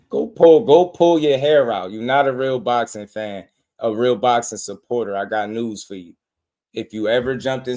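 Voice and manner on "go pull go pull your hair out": loud jeer coming out of a laugh; loud mocking taunt. Real, not acted. Host mocking a ranting fan after the clip, loud taunt. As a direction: belt it out to ridicule them.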